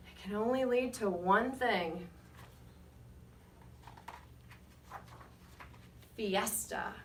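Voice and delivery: put-on lively read-aloud to children, rising on names; story narration. A narrator's reading. Reading to the class with lively storybook narration. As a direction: tell it like a picture book to a class. Reads picture book aloud.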